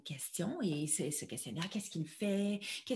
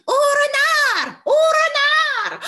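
very high, loud, slow alarm cry; mother crow shouting; 12 dB louder, much slower than usual. Acted. Mother crow warning of the fox, high slow shout. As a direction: raise the alarm at full voice. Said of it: "la maman de Gilles crie".

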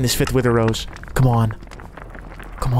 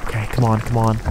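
ordinary talk, nothing marked

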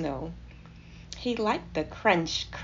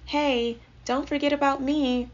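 lightly voiced higher mouse; Timothy; 6 semitones higher, 4 dB louder than usual. A narrator's reading. Mouse piping up from teacup bath, lightly pitched up. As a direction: pipe up a little, wanting notice. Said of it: "said Timothy from a teacup bath".

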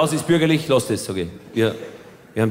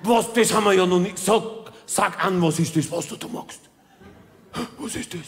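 puzzled villager voice; 4 semitones higher than usual. Retold or imitated. Indians puzzled by the clapping.

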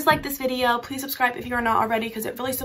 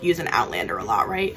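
normal talking voice

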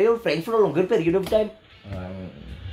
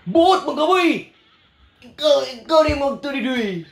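excited shout; 10 semitones higher, 4 dB louder than usual. Real, not acted. Excited call-out to the boys.

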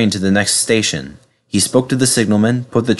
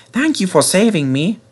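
timid engine voice; 9 semitones higher than usual. Acted. James's timid voice.

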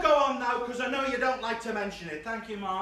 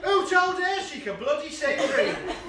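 higher and louder, laughing through it; laughing mock mum; 9 semitones higher, 7 dB louder than usual. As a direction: cackle out the cheeky text like mum. Reading out mum's rude texts.

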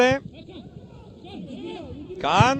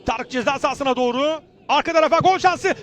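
ordinary talk

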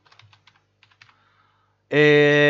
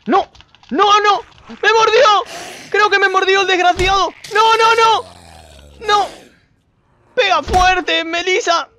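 high, loud panicked yells at the bite; panicked screaming; 12 semitones higher, 5 dB louder than usual. Real, not acted. Player bitten by a zombie, screaming in panic. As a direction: shriek in real alarm.

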